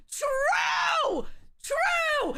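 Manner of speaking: excited shout. Real, not acted. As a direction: yell it in agreement. Emphatic agreement with chat.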